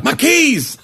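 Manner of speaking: high-pitched mock-panicked cry; comic panicked outburst; retold panic. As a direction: yelp it like a sudden frantic realisation. Retold panic over lost keys.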